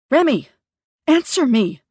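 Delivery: high, loud barked command; barked order. Acted. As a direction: snap at him to answer. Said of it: "I bark".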